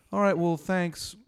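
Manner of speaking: embarrassed oddball taking his leave; awkward thanks. Acted. Embarrassed guy leaving after voice crack.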